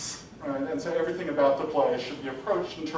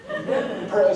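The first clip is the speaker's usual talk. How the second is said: usual speaking voice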